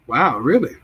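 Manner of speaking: usual speaking voice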